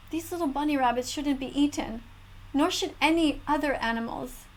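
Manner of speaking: usual speaking voice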